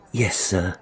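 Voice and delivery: ordinary delivery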